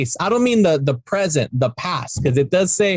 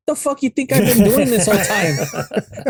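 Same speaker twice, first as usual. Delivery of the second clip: higher-pitched mock indignation; imitated exasperated look; 5 semitones higher than usual. Kid silently answering Ernest, mock exasperated. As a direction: voice an incredulous look, half laughing. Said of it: "gives him this look like".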